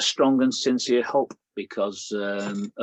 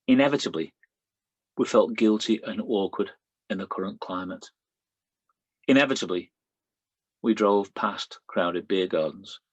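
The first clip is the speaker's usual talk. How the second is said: poem reading. Acted. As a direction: let each inevitably fall and rest. Reads a personal poem.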